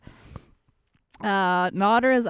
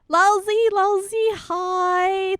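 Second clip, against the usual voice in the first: excited greeting; 10 semitones higher, 4 dB louder, much slower than usual. Real, not acted.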